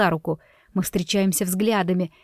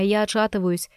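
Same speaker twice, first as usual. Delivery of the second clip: ordinary delivery, nothing marked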